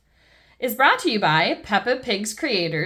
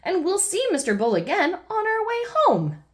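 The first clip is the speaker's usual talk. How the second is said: Daddy Pig soothing; 10 semitones higher than usual. Acted. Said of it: "adds Daddy Pig".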